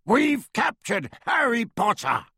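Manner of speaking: high, gloating werewolf shout; triumphant roar. A narrator's reading. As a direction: crow the capture proudly. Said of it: "Greyback roared triumphantly".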